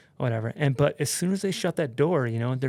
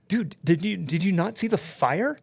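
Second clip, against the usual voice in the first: stammered, higher-pitched disbelief; incredulous; 5 semitones higher than usual. Retold or imitated. Passenger to his French friend, stammering disbelief. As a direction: act out being baffled she missed it.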